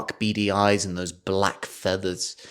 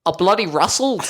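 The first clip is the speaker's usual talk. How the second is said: put-on high, proud boyish answer; Norbert voice; 8 semitones higher, 3 dB louder than usual. Norbert answering mum, high and proud. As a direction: say it like a chuffed lad. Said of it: "And he goes".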